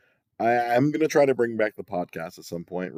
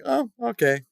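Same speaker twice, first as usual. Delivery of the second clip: mock surprised. Quoted own reaction, high.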